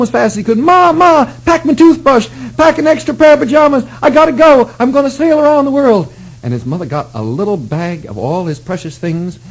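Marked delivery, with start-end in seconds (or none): 0.6-6.1 put-on high, breathless excited kid; excited boy. Boy calling to his mother, thrilled and much higher. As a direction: burst in shouting to Ma.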